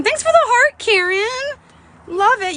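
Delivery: very high, loud sing-out of thanks; delighted thanks. Real, not acted. Thanking a viewer for a gift.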